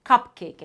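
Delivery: Cupcake said loud in two clear beats, cup and cake; clapped syllables. Shown as a demonstration. Parent clapping out a word for a child. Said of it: "clap out the parts in that word".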